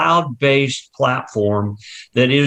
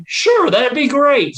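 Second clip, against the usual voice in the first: eager customer; 13 semitones higher than usual. Acted. Customer agreeing in roleplay.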